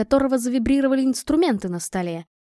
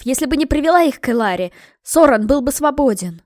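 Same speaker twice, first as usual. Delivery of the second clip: anguished shouting; 4 dB louder than usual. Acted. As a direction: yell the blame at yourself. Mei cries out guilty outburst.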